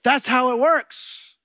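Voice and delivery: puffed-up victorious husband; smug triumph. Retold or imitated. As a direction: crow it like you just won. Mock triumphant reply to wife's note.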